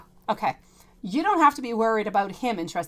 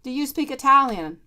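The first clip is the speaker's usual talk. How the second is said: son asking; 5 semitones higher, 7 dB louder than usual. Retold or imitated. Son asks if she speaks Italian.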